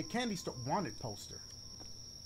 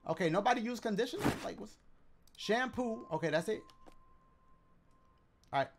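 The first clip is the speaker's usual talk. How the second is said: ordinary talk, nothing marked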